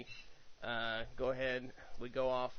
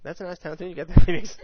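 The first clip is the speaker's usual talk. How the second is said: fast and much louder mock praise; loud sarcastic compliment; 5 semitones higher, 19 dB louder, faster than usual. Mock compliment over music.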